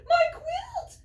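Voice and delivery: very high, loud cry of alarm; little quail shrieking in panic. Acted. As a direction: yell for the stolen quilt. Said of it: "cries Quincy".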